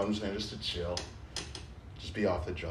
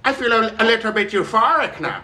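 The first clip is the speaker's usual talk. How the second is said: mock British accent pitched up and louder; higher, louder put-on English voice, giggling at the end; 7 semitones higher, 4 dB louder than usual. Riffing with his friend on the strain: higher British accent. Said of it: "different English accents".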